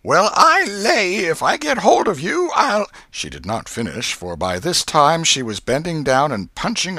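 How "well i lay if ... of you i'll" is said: high, loud scolding, not fierce; loud threat. A narrator's reading. Aunt threatening the hidden boy, loud threat. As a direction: threaten loudly to the whole room. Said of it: "loud enough for the furniture to hear".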